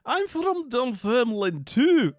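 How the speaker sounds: high, loud, slow excited cry. Retold or imitated. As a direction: announce it with delight. Excited stranger runs over quoted.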